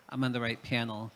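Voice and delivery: ordinary talk, nothing marked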